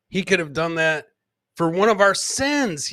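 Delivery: usual speaking voice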